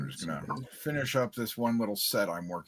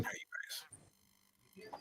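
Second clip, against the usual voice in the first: whispering; 19 dB quieter, much slower than usual. Real, not acted. Quiet whispered greeting.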